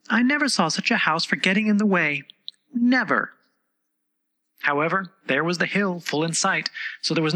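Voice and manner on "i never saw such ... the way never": high-pitched exasperated complaint; crying out. A narrator's reading. Alice scolds the house for getting in the way, crying out. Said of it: "she cried".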